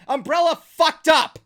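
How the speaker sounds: loud, drawn-out verdict; emphatic rant. Real, not acted. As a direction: hammer each word home. Climax of rant on Umbrella.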